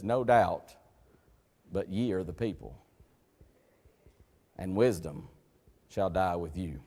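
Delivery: low, soft, dry reading of the verse; understated sarcastic scripture reading; quiet sarcasm. Retold or imitated. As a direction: let the sarcasm sit quietly under the words. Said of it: "that's what we call in English sarcasm".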